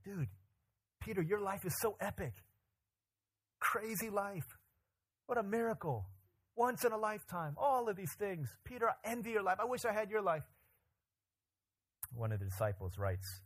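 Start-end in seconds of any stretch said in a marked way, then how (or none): 0.0-10.4 high, excited, gushing fan voice, much higher; mock an over-the-top admiring follower. Friends gushing in comments.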